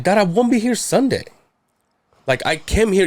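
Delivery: exasperated, pitch pushed up; getting pissed. Retold or imitated. Son tells dad he can't wait till Sunday, pissed. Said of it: "I'm fucking getting pissed".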